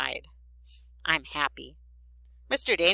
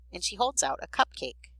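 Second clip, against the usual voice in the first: ordinary delivery, nothing marked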